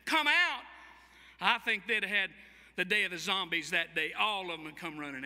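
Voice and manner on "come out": louder and higher ringing command; commanding call. Acted. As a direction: call it into the tomb. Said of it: "had Jesus just said".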